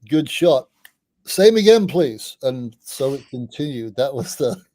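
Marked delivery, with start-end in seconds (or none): 0.1-2.4 calling out loud for another round; loud retold order. Daryl at the club, calling for another round, loud and boozy. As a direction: belt it like a drunk mate at the bar.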